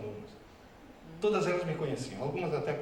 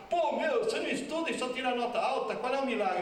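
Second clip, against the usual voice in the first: annoyed classmates; 8 semitones higher than usual. Retold or imitated. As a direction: whine at him like a jealous colleague. Classmates pestering him.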